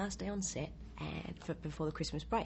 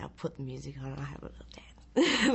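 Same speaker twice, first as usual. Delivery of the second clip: sneaky whisper to herself; whispered plan; 5 dB louder than usual. Retold or imitated. Actress alone in her trailer, whispering a mischievous plan. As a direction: whisper it like a naughty secret. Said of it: "And then I went".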